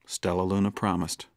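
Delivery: plain reading voice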